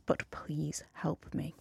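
soft pleading whisper; whispered plea. Retold or imitated. Host whispering the fan's plea for skincare help. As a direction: breathe it like a desperate secret.

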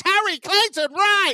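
high, slow, drawn-out stage announcement; announcer shout. Acted. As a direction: belt the name like a compère. Please welcome to the stage.

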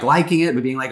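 ordinary talk

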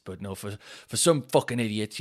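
ordinary delivery

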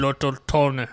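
mock-German, rounded vowels; attempted German accent. Co-host retrying the villain's name after sounding Russian, mock German accent. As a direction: another wobbly stab at German. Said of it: "Little Tony with a German accent".

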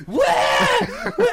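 hammed-up imitated "wah" cries; mock yelling. Storyteller imitates his friend holding the tail, mock yelps. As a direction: yelp like the friend wrestling a snake. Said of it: "And he's like".

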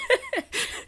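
quick, very high laugh; a short high giggle. Real, not acted. Laughing over bag.

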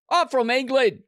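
high, put-on English accent that sounds Australian; attempted English accent. Shown as a demonstration. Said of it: "I think it's Australian too".

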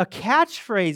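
ordinary talk

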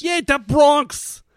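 slow, loud, high mock Bronx; drawn-out, hammed-up Bronx caricature; Bronx accent. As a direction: holler it in a broad Bronx accent. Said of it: "Is this a good Bronx accent?".

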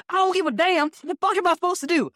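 high, fast furious complaint; high mock yell. Driver shouting at the manager, high and fast in outrage. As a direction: rattle it off in outrage.

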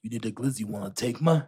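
low, soft hint of a rapper's growl. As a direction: coax her by doing the rapper's line in a low mumble.

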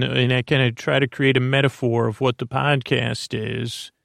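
ordinary talk

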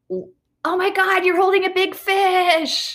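high, slow gushing praise; praising a guy holding a fish, high and slow; mock praise. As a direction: gush over a small feat. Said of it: "someone to be like".

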